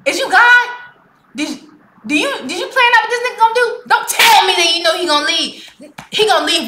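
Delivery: mock-outraged lecture at the singer; indignant rant. Real, not acted. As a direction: call him out hotly. Ranting at the singer.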